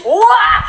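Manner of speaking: sudden high yelp of fright; scared yelp. Retold or imitated. Girl hearing voices in the dark, high frightened yelp. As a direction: jolt out a frightened cry.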